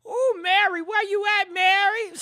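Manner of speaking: hollering for her like she's hiding, half laughing; mock calling out. Host teasingly calls out to [name], laughing loud call.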